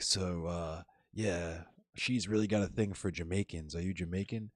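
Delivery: low, soft, halting question; hesitant stranger. Retold or imitated. Man approaching a stranger at a bar, imitated as hesitant and quiet. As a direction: mimic a man sheepishly feeling his way into a question.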